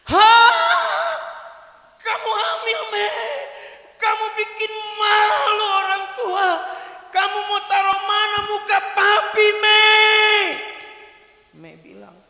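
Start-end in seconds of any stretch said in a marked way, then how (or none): 0.1-10.6 high, loud shocked scolding; father yelling in shock and shame; outraged father. Acted. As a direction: blow up at your daughter in disbelief. Father explodes at pregnancy news.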